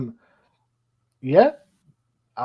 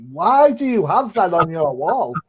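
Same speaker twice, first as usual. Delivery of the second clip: slower, higher, louder disbelieving question; mock outrage; 5 semitones higher, 6 dB louder than usual. Host mocks a friend's Packers poster, mock outrage. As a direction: act baffled at the friend's poster. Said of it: "And I'm, I'm, I'm like".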